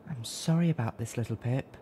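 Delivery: quiet tender sorry; gentle apology. A narrator's reading. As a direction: soothe her kindly. Velvet apologizes to Pip.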